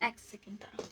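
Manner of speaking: whispered aside, barely there; a quick whispered hold on. Real, not acted. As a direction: hush it out while busy off camera. Aside while busy off camera.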